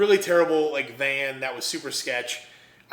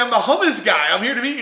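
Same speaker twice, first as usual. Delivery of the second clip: loud, higher-pitched insistence; comic protest, voice raised; exasperated correction; 7 semitones higher, 6 dB louder, faster than usual. Retold or imitated. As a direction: act out flustered explaining. Retold correction of the manager.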